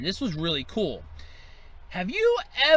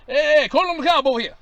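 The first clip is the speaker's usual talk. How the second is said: louder, higher call across the yard; 7 semitones higher, 6 dB louder than usual. Acted. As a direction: shout for a guest at a barbecue. Calling across a barbecue.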